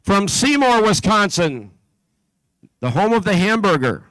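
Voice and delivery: steady, carrying PA announcer voice; announcer read. Acted. Announcer calling out teams to the competition crowd, steady and carrying. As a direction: call out each town and team number to the hall.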